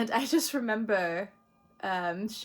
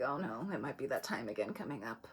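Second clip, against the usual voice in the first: lower, softer voice of dread; quiet, low foreboding inner voice; 9 semitones lower, 10 dB quieter than usual. Retold or imitated. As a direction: mutter a grim premonition under your breath. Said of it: "The Scorpio nature within me is just like".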